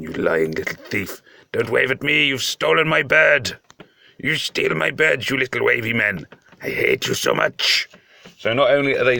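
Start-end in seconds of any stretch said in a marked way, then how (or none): 0.0-7.9 mock furious scolding. Gamer ranting at the wavy man who stole his bird, mock angry. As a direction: berate the little thief in mock outrage.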